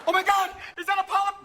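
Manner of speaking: high panicked shout. Pretend. Patient watching his colon on screen, panicked shout. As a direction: shriek it in alarm.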